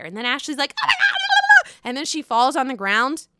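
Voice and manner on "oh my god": much higher, louder mock shriek of shock; shocked gasp. Ashley reacting to the hockey player claim, mock shocked shriek. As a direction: squeal it like a stunned housewife.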